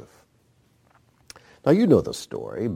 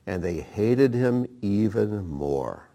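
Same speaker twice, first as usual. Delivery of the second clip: hated him said slowly and evenly; calm, deliberate scripture reading; slower than usual. Acted. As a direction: read it out slowly, measured.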